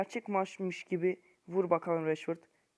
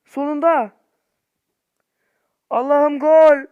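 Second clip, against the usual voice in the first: higher, much louder cry of joy; ecstatic shout; 7 semitones higher, 15 dB louder, slower than usual. Real, not acted. Gamer finally scoring after an hour, ecstatic shout. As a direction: scream with relief at last.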